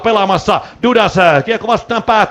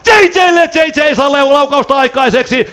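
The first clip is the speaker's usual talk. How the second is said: excited shout; 7 semitones higher, slower than usual. Real, not acted. Commentator to listeners as the pass reaches the shooter, excited shout. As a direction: yell the pass as the chance comes.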